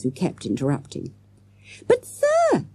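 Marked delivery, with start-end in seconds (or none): 1.9-2.7 put-on very high pleading child; urgent cry. A narrator's reading. Jessica interrupting her teacher, high urgent cry. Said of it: "cried Jessica again".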